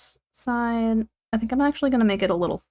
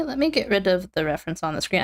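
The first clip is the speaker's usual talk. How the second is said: ordinary delivery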